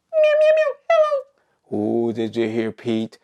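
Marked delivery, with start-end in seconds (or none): 0.1-2.1 very high squeaky cat meows into a hello; cat language. Pretend. Pete the cat greeting the class back, high cat voice. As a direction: squeak it out like a pretend kitty. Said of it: "That is cat language".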